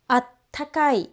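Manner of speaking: "attakai" with a held stop before ka, emphatic. Shown as a demonstration. Teacher showing the emphatic form of warm. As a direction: snap the small tsu in "attakai". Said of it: "you want to emphasize the word".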